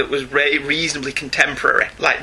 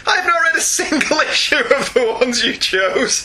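laughing; 9 semitones higher than usual. Real, not acted. Laughs mid reading.

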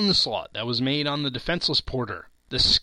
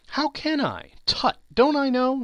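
much higher lightly voiced wife; a playful, teasing young wife; wife voiced, laughing; 12 semitones higher, 4 dB louder than usual. A narrator's reading. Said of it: "laughing as he laughed".

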